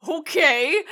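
loud, high, disbelieving okay; incredulous reaction. Retold or imitated. Podcaster reacting to photographers' supposed ethics, incredulous. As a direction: blurt it out high and loud in mock acceptance. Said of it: "sitting here going like".

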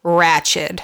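Ratched ending in a clear d; careful Ratched. Shown as a demonstration. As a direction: say Ratched with a crisp final d. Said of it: "Not ratchet".

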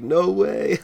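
mock surprised no way; excited. Retold excited reaction.

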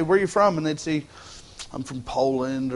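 usual speaking voice

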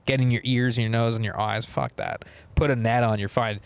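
normal talking voice

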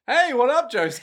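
high, loud casual hey; cheery greeting. Acted. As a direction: call out a bright hey. Okuyasu's cheery return.

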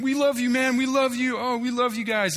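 very high-pitched, hammed-up weepy gushing; mock tearful affection. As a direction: squeal it like an overwhelmed hugger, imitated. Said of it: "embracing us with tears".

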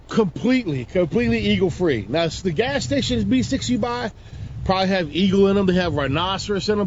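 usual speaking voice

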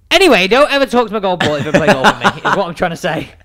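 heated but amused outburst; fuming. Real, not acted. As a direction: lay down the law, half in jest. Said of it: "Oh, he is fuming here".

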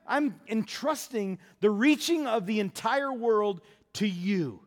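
loud, high, pleading frustration, slowing at the end; frustrated Jesus. Retold or imitated. Jesus to the nine disciples, loud frustrated plea. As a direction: pour out disappointment at full voice.

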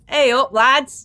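slow, high northern English call to the lads; mock northern accent. Host hamming it up, slow mock northern greeting. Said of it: "northern accent".

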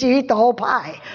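normal talking voice

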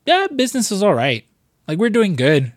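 mock casual restaurant owner; laid-back owner. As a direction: shrug it off like nothing's wrong. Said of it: "he shows up and it's just like".

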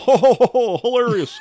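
loud, high fake belly laugh; mock hilarity. Mocks the joke gift's hilarity.

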